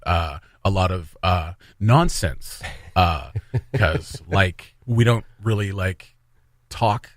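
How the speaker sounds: low, halting voice padded with uhs; Trudeau impression. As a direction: break every phrase up with hesitant uhs and likes. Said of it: "it's a pretty spot on impression".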